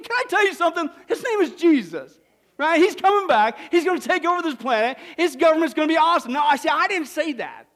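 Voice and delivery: ordinary delivery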